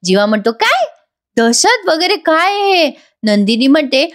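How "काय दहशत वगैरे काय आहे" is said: high, slow, disbelieving voice; astonished. Retold or imitated. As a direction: act stunned, stretch out the question. Jeeva baffled by her word.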